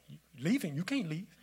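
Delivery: high, loud, swooping protest; mock alarmed outburst. Replaying his video call to a departing friend, mock alarmed outburst. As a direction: shout it in exaggerated disbelief.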